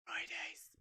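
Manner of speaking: soft whisper; hushed one-word whisper. Pretend. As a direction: whisper it under your breath. Whispers a word under reading.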